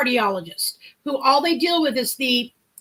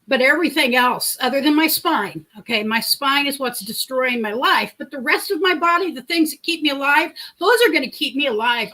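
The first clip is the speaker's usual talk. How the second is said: ordinary delivery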